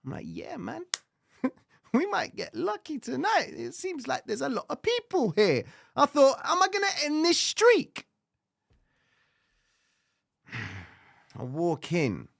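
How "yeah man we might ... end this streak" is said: higher, eager, hyped up about the night; hopeful excitement. Retold or imitated. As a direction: imitate yourself getting your hopes up. Hopeful retold inner voice.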